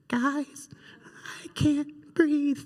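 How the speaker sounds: high, thin, slow plea; strained little cry for help, broken by pauses; faint trapped voice. Retold or imitated. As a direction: imitate a boy squashed inside a couch. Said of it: "we hear the faintest voice from within".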